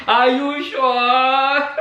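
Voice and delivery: laughing disbelief. Real, not acted. Vlogger repeating her doubt to viewers, laughing. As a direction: chuckle then ask it slowly again.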